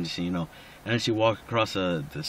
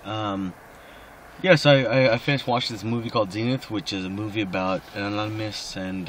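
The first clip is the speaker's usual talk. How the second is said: ordinary talk, nothing marked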